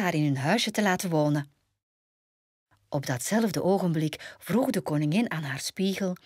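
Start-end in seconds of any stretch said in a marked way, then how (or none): none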